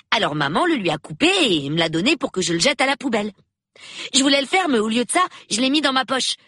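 put-on high, rapid child's chatter; excited young boy. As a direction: rattle out the tale like an eager boy. Boy tells of his find. (voix aiguë de gamin, débit rapide)

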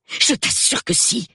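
high, emphatic wife; insistent assurance. Acted. Wife assures doubting husband, insistent.

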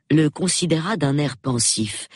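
ordinary delivery, nothing marked

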